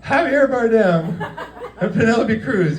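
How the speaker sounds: high, slow stressed names, laughing; mock exasperation. Coworker revealing the celebrities, mock exasperated. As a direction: spell out the famous names in disbelief.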